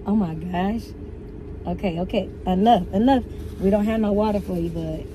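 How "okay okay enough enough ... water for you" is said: ordinary talk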